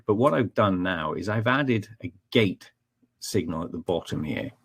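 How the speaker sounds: usual speaking voice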